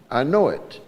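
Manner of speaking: ordinary delivery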